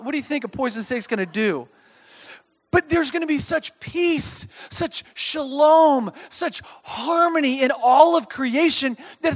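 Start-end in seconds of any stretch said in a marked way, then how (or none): 2.7-9.3 rising preacher shout. Real, not acted.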